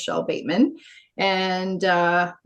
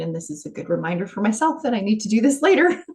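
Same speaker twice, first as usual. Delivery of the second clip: normal talking voice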